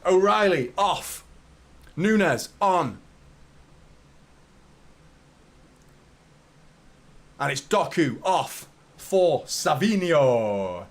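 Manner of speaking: slow, drawn-out announcer call; stadium announcer. Pretend. Streamer announcing the substitutions to viewers, stadium announcer style. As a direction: boom it out like the PA announcer.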